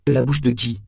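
rattled suspect pushing back; agitated demand. A narrator's reading. Accused suspect demands the source.

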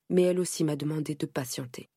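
plain reading voice

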